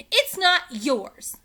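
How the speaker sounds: high loud slow protest; angry shout. A narrator's reading. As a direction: shout her objection. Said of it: "shouted Annie".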